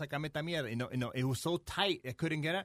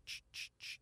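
unvoiced-sounding soft "ch" puffs; short, quiet air-pump bursts; imitated pump noise; 13 dB quieter, slower than usual. Guest shows how the pump worked, hushed puffing noise.

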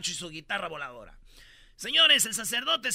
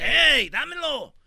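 slow, louder mock grab-it yell; 5 semitones higher, 7 dB louder, much slower than usual. Godfather snatching the baby, hammed-up yell. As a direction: demand the baby back loudly. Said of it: "Se dice".

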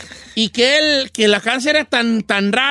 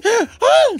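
short high yelp of fright; mock startled gasp; 11 semitones higher than usual. Lady sees a stranger at her door, high startled yelp. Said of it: "la señora lo ve y dice".

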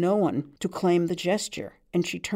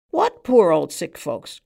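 louder, slow dismissive cry; cried out; 7 dB louder than usual. A narrator's reading. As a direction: throw the question back, loud and slow. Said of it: "cried the man on the stool".

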